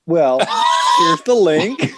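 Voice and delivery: slow, laughing through the words; laughing reply. Retold or imitated. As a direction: hand over the link, cracking up. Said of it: "I was like".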